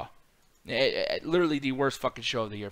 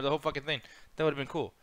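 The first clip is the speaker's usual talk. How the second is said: usual speaking voice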